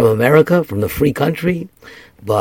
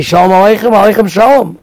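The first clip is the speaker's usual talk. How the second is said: greeting; 9 semitones higher, 7 dB louder than usual. Retold or imitated. Imagined greeting left unsaid.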